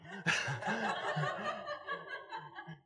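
short high laugh breaking into the bit; a squeaky laugh at his own impression; laughing mid-story. Real, not acted.

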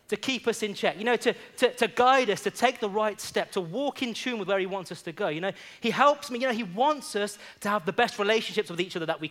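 high, loud, rousing preacher's cry; preaching at full force. Acted. Pastor exhorting the church to yield, in full preaching voice. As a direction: lift the church with each line.

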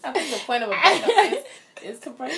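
laughing through the words; giggling while saying it. Real, not acted. Laughing through her joke.

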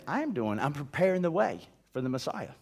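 God patiently answering the complaint, soft and low. Acted. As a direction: reassure them quietly.

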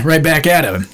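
usual speaking voice

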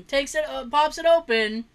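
ordinary delivery

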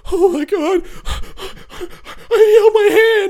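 high, loud overwhelmed sob; crying. Retold or imitated. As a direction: cry it out in disbelief. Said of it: "my first reaction was like".

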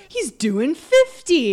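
mock amazed, stretched-out words; drawn-out quote. Host mimics a cop clocking Jet Li's speed, drawn-out mock amazement. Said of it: "the guy goes".

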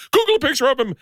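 high-pitched mock urgency; excited daughter. Daughter wants dad to find the bear victim, high excited demand. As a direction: imitate a kid's high, eager demand.

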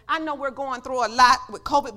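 ordinary delivery

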